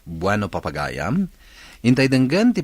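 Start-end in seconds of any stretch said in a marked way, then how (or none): none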